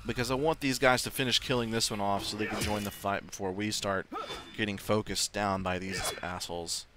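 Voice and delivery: normal talking voice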